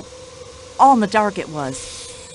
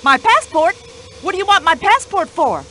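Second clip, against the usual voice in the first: indignant surprise; 6 semitones higher than usual. Acted. Indignant American witness protests.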